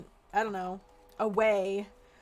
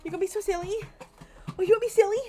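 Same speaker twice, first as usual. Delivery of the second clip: excited dog talk; 9 semitones higher, 6 dB louder, much faster than usual. Real, not acted. Owner teasing her silly dog, high playful baby talk.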